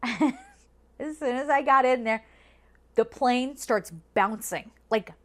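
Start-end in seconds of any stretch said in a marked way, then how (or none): none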